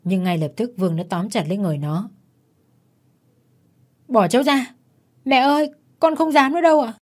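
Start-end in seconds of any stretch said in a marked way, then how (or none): 4.1-6.9 put-on high-pitched, frightened child's begging; acted terrified plea from a child; pleading child. A narrator's reading. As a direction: beg in a small boy's raised voice. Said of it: "Mặc cho đứa bé gào thét van xin".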